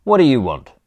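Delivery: loud, indignant challenge; demanding. A narrator's reading. Hostile don to intruders on the lawn, demanding. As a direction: confront the intruders head-on. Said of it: "the fatter fellow demanded".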